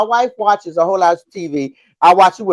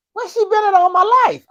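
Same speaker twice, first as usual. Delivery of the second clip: amazed; 12 semitones higher than usual. Retold or imitated. Quoting amazed future reader.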